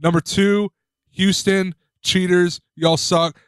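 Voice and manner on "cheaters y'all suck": ordinary talk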